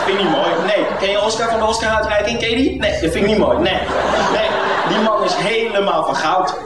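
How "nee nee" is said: ordinary delivery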